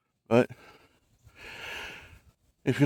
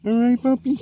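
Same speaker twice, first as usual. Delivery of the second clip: high puppy talk; 12 semitones higher, 7 dB louder than usual. Real, not acted. Calling to the dog.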